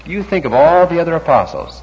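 ordinary talk, nothing marked

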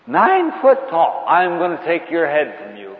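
high, slow, put-on boastful voice; a hint of a cocky fighter squaring up; mock defiant challenger. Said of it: "Have you ever said that to a nine-foot-tall individual?".

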